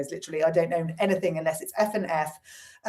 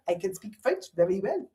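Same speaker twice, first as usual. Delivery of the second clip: put-on softer, comic French-accented English; English words said in a mock French accent; 5 dB quieter than usual. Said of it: "say English words in their accent".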